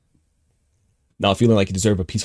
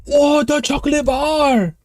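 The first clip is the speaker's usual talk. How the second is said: shocked; 4 dB louder, much slower than usual. Real, not acted. Cat brings back the chocolate.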